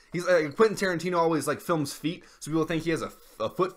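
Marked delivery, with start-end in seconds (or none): none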